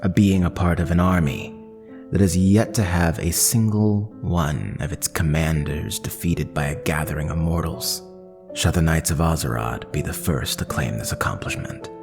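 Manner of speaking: ordinary talk, nothing marked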